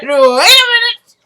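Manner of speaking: high, loud, slow stunned wait a minute; shocked disbelief. Retold or imitated. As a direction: stretch it out in outraged shock. Own shocked reaction to scene.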